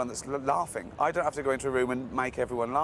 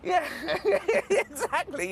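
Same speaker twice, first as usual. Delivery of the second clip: squealing laugh then exactly; high laughing; slower than usual. Real, not acted. Agreeing with the tall jibe, laughing.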